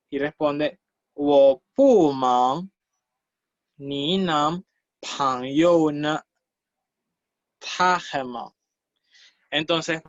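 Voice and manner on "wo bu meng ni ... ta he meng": very slow, emphatic Mandarin reading; deliberate, drawn-out teacher's model. Shown as a demonstration. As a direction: stretch each syllable out for beginners. Teacher models textbook dialogue reply.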